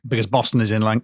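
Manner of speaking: usual speaking voice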